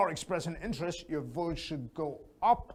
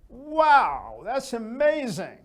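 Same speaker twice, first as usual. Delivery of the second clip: much higher and louder "Wow, that's amazing"; excited; 6 semitones higher, 8 dB louder, slower than usual. Shown as a demonstration. Teacher showing the excited way, high and loud. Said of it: "Completely different".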